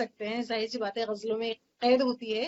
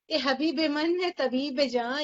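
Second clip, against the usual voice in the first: emphatic recitation; 4 semitones higher than usual. Shown as a demonstration. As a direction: call out the address with passion. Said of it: "repeats the line".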